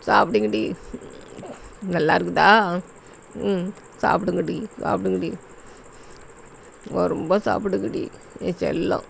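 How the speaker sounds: soft, low coaxing to eat; mother softly feeding her babies; tender coaxing. Acted. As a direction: hush and coax the little ones to eat.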